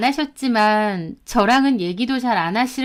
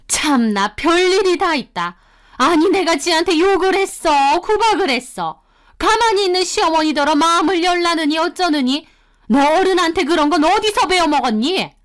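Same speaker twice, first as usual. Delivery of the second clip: higher, indignant mother-in-law voice; mother-in-law taking offense; 6 semitones higher than usual. Acted. Mother-in-law rebuking her daughter-in-law, indignant and higher. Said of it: "더 큰 소리로 화를 내시더라고요".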